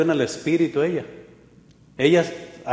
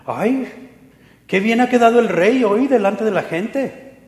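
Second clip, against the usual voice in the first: high, sneering sarcastic jab; mock the king with a scornful sing-song; mocking sarcasm; 8 semitones higher, 5 dB louder than usual. Wife scorning David's dancing before the people, sarcastic. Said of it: "casi me lo imagino con ese tono".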